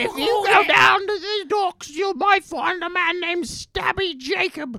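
high, loud mock-British storyteller; fake British accent. Continues the fake British impression.

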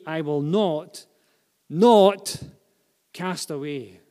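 pause, then hit the repeated "not" hard; emphatic negative. Shown as a demonstration. Preacher stressing the Greek emphatic negative, hard stress on "not". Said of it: "The emphatic negative".

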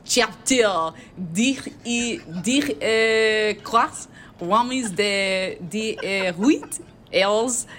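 deliberately horrible, halting French accent. Shown as a demonstration. Reading an email in French accent to tease a friend, hammed-up French accent. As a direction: stumble slowly through the numbers in a mangled French accent. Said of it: "Should I read it in my French accent?".

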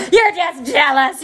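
high, loud, drawn-out hammed-up comeback; mock taunt. Messy employee to boss, loud high mock taunt. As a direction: squawk the taunt slowly, hamming it up. Repeats comeback, more exaggerated.

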